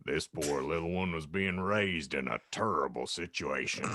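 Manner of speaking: low, soft, grave character voice; mock-solemn low concern; concerned Hagrid. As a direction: drop the pitch and sound worried for the child. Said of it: "Hagrid declared concernedly".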